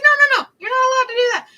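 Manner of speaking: very high, loud squealed refusal; hammed-up panicked refusal of a hug; mock protest. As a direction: shriek it like a panicked friend.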